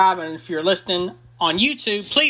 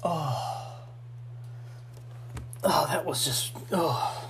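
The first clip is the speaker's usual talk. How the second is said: breathless whisper; 10 dB quieter, slower than usual. Real, not acted. After the burning sip.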